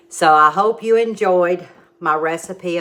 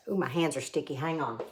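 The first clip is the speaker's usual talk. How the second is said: quiet aside; 2 semitones lower, 11 dB quieter, faster than usual. Real, not acted. Aside about sticky hands.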